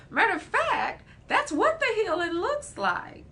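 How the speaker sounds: high, emphatic declaration. Real, not acted. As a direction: land it with excited conviction. Emphatic punchline, much higher.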